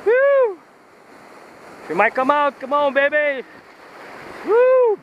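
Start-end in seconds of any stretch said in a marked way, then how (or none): 0.1-0.6 high, loud whoop of real thrill; sudden joyful yell; excited whoop. Real, not acted. Fish running line out. 4.5-5.0 high, loud whoop of real thrill; sudden joyful yell; excited whoop. Real, not acted. Fish running line out.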